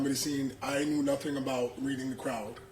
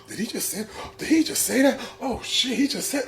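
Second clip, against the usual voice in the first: higher, rising mock disbelief; imitating shocked crowd; 7 semitones higher than usual. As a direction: play the stunned audience. Crowd's shocked reaction to offensive joke.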